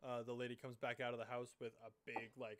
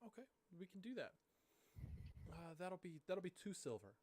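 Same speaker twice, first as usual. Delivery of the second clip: higher, soft polite farm lady; lady's voice; 6 semitones higher, 4 dB quieter than usual. Acted. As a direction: gently quote the price as the lady. GM voices Sasha selling.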